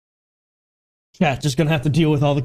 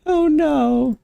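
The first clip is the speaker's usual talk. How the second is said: high-pitched mock "oh no"; much higher, put-on dismayed cry; 14 semitones higher than usual. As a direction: squeal a playful, exaggerated dismay, high and sliding down.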